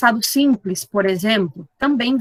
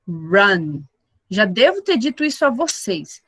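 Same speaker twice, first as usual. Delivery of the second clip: ordinary talk, nothing marked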